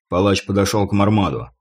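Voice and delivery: ordinary delivery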